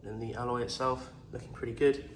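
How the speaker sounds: normal talking voice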